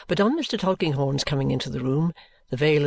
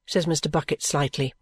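usual speaking voice

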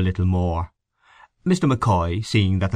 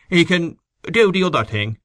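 higher, emphatic retort; blunt; 9 semitones higher, 3 dB louder than usual. A narrator's reading. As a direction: dismiss it bluntly. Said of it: "he said bluntly".

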